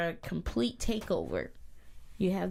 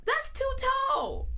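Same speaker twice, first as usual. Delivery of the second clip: high-pitched protest. Real, not acted. Complaining again, higher.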